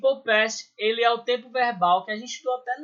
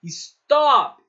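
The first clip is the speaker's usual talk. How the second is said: "Stop" with a loud, drawn-out "top"; stress on TOP; 3 semitones higher, 7 dB louder than usual. Shown as a demonstration. Teacher showing where stop is stressed, emphatic. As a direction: punch the "top" part of "Stop". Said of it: "o top é a minha sílaba tônica".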